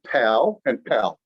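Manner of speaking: pal and pal, plain p then aspirated p, slow; Korean p contrast. Shown as a demonstration. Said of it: "Here's one I tried this morning".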